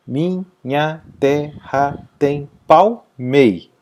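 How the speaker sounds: slow syllable-by-syllable "Minha terra tem palmei", stopping on "mei"; poetic syllable count. Shown as a demonstration. Teacher showing poetic scansion, "Minha terra tem palmei" slowly syllable by syllable. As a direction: separate every beat and cut off after stressed "mei". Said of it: "Parou na última tônica".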